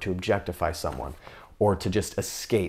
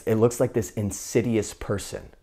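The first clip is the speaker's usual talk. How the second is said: ordinary talk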